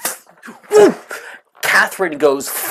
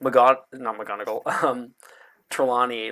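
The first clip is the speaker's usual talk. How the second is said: normal talking voice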